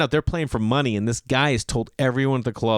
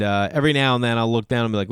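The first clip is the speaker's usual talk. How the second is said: ordinary delivery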